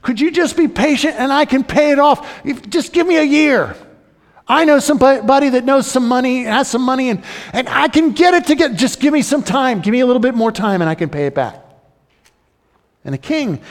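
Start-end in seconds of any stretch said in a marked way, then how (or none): 0.0-11.6 high, begging plea that rushes at the end; pleading servant. Retold or imitated. Servant on his knees begging the king for time. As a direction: beg the king for a little more time. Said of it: "He's saying".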